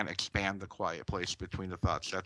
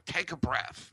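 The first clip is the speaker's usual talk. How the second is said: mocking snap of advice, pitched higher; sarcastic; 8 semitones higher than usual. Someone upset being told sarcastically to breathe. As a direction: toss it off as a sarcastic jab. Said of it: "they say it sarcastically".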